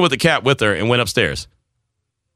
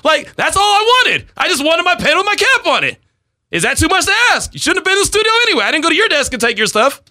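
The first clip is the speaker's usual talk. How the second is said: pitched-up indignant rant; worked-up rant. Real, not acted. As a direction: let it all out, laughing at yourself. Heated rant about the pen.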